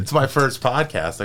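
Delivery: beaming about it; excited. Real, not acted. Excited guest.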